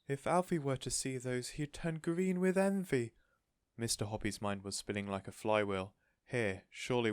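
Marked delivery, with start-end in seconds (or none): 0.1-3.1 eager cry. A narrator's reading. Missus Silver longing for a bigger tortoise, eager. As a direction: burst out with longing.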